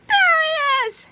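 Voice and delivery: very high, drawn-out shriek; angry shout. A narrator's reading. Angry old ladies spot Melvin, high shout. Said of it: "shouted a couple of very angry old ladies".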